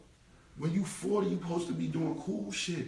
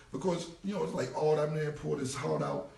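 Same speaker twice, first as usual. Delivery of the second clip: ordinary talk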